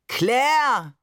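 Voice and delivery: loud, drawn-out dramatic call; theatrical call. A narrator's reading. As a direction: call after her like a stage lover. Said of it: "rief Barbe theatralisch". (theatralischer, lauter Ruf)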